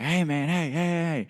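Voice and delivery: high, insistent hailing in imitation; retold holler. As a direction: imitate a stranger calling out to get attention. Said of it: "this guy, like, hollers at me".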